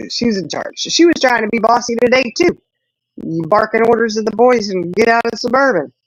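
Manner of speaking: ordinary delivery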